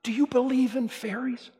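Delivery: rising plea to the crowd; high boyish voice asking the audience; Peter Pan asking. Retold or imitated. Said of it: "He says".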